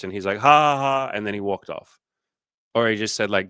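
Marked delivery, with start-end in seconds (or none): 0.4-1.1 loud, high imitation guffaw; mock drunken laugh. Imitating the groper laughing in her face, mocking laugh. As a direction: laugh it off like an oblivious lout.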